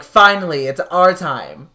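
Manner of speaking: loud Finally, then slow it's our time; hammed-up triumph of the effects crew; mock excitement. Effects crew finally gets their big moment, hammed-up. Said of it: "The special effects guys were like".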